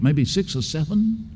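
ordinary talk, nothing marked

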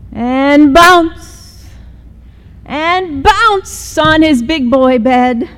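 a kitten bouncing on his bed, high and lively; bouncy excitement. A narrator's reading. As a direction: make the words bounce like the kitten. Bouncing mimicked in voice.

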